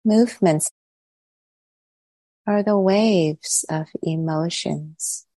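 calm, unhurried meditation voice with long pauses; guided meditation. Real, not acted. Teacher settling the group into body and breath, calm guided meditation. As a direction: guide them gently inward, leaving long silences between phrases.

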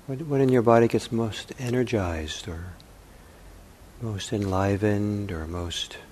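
unhurried meditation voice with long pauses; calm contemplative guiding, phrase by phrase; guided meditation. Acted. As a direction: softly offer questions, leaving silence between them.

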